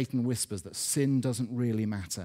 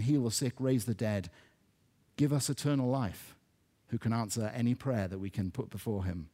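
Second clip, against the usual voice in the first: ordinary talk, nothing marked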